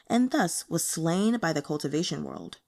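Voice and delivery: plain reading voice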